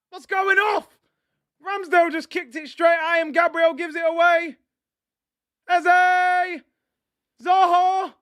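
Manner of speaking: real panic as Palace break through; frantic disbelief. Real, not acted. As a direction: yell at the screen as the attack unfolds. Exasperated at keeper's mistake.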